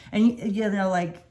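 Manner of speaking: ordinary talk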